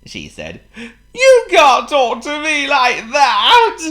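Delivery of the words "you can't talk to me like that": high, slow, loud sobbing; hammed-up tearful wail; sobbing king. A narrator's reading. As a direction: protest tearfully, drawing out the words. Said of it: "sobbed the king".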